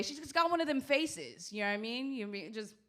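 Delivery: ordinary talk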